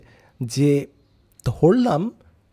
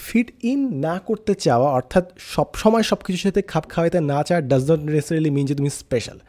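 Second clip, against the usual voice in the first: ordinary talk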